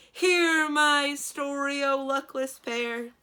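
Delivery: loud, slow, high booming giant voice; relieved djinn. A narrator's reading. Djinn begins his story to the pair, slow and booming. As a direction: launch grandly into his tale. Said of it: "with apparent relief".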